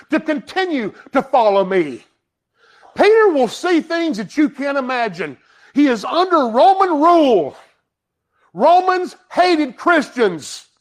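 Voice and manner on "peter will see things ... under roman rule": ordinary talk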